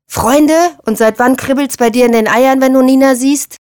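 loud, high, indignant questioning; heated, incredulous retort; angry challenge. A narrator's reading. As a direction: throw it back as an accusation.

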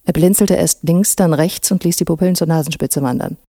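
usual speaking voice

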